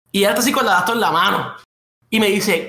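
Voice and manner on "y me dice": ordinary delivery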